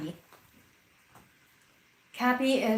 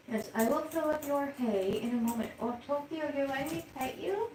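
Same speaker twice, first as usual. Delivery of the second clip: soft pet talk; 5 semitones higher, 7 dB quieter than usual. Real, not acted. Talking to her guinea pigs.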